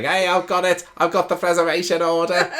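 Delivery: loud, high Scouse-tinged shout; excited Liverpool accent, hammed up. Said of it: "that was a bit Scouse".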